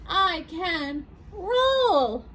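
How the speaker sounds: high, drawn-out proud discovery; triumphant hot dog. Acted. Hot dog realizes what it can do, slow high triumph. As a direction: stretch it out slowly in a high, delighted voice.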